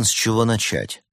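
plain reading voice

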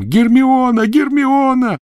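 very high, loud, drawn-out scream; yell. Acted. Ron yelling for Hermione from the cellar. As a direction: bellow her name in anguish.

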